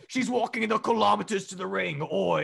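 lightly hammed British announcer; British accent. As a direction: imitate a British commentator, ending on "oi". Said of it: "Wade saying".

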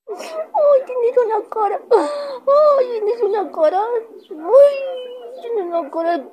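high, cooing pet talk; mimos a la perrita. Real, not acted. Mimando a la perrita. (voz aguda y melosa de mimar al perro)

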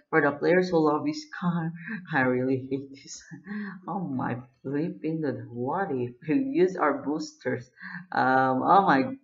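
usual speaking voice

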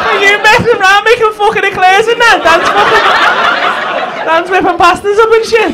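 high, loud hyped-up ribbing; big him up loudly, pitched high; mock excitement. Hyping Dan's cooking to tease Kelsey.

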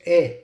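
E said as an open "eh"; E pronounced "eh", as in some regions; not long E. Shown as a demonstration. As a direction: say E as "eh" instead of "ee". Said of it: "not pronounced as a long E".

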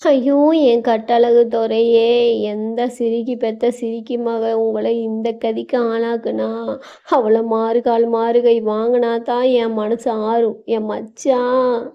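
slow, drawn-out mourning wail; lightly voiced village mother keening; keening lament. A narrator's reading. Mother-in-law mourning her wounded son, slow keening. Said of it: "மாமியாரின் கதறலில்".

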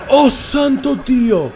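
high, drawn-out cry of shock. Real, not acted. Player reeling from a jumpscare, shocked cry. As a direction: stretch it out high, reeling from the fright.

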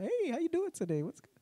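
high, breezy, carefree hello; casual breezy greeting. Retold or imitated. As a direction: greet her as if nothing ever happened. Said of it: "I'm just regular".

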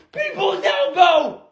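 high, slow wrestler shout; wrestling call. Acted. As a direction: announce the move like a wrestler. Wrestling move called out.

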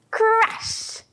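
high, bursting crash; big crash. Shown as a demonstration. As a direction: throw the word down like a wave. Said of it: "I can crash my wave".

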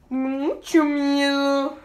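very slow, stretched mucho miedo; drawn out fear. Pretend. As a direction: drag it out like a scared kid. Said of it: "Tengo miedo". (miedo exagerado y muy lento)

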